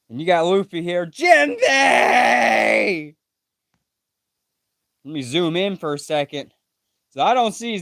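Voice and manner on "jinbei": high cry calling out Jinbei; anguished shout. Acted. Luffy screaming for Jinbei, anguished shout. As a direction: yell his friend's name in despair.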